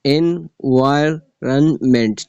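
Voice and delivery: "Environment" very slowly in four separated syllables. Shown as a demonstration. Teacher showing four syllables, "Environment" split syllable by syllable. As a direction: break "Environment" into en, vi, ron, ment with gaps. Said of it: "चार syllable है इसमें".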